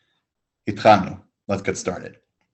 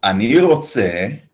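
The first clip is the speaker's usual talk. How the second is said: slow, drawn-out "Ani rotze"; slow model answer; 4 dB louder, much slower than usual. Shown as a demonstration. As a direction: say it slowly for the class. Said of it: "How does Adam begin".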